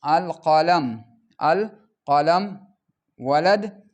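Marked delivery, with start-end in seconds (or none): 0.1-2.4 القلم ending in a single short -u; single damma ending. Shown as a demonstration. Teacher modelling al-qalamu for the class. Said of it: "ضمة واحدة".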